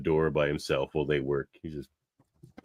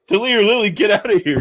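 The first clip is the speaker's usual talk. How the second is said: much higher, much louder frantic shout; mock panicked yell; 10 dB louder than usual. Beverly warning her crew before the blast, frantic shout.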